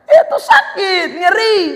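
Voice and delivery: high, drawn-out wince; hammed-up cry of hurt; mock pain. Preacher pictures Joseph hurt in the well, mock pain.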